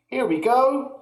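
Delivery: excited cry. A narrator's reading. George cheering as he gives the dose, high. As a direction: call it out gleefully. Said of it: "George cried out".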